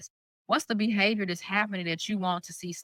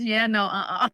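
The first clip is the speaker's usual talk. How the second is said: playful refusal; 2 semitones higher, 4 dB louder than usual. Retold or imitated. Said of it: "Mine is".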